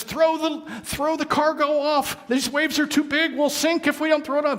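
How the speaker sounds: high, urgent orders over the storm; shouting captain. Acted. Captain shouting to his crew in the storm, urgent and high. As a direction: bark it out over crashing waves.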